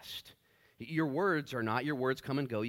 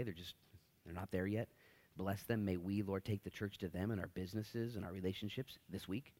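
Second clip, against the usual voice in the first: lowered, hushed pastor's prayer that swells at the amen; 5 semitones lower, 5 dB quieter than usual. Acted. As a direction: pray it quietly over the church. Pastor leads a congregational prayer.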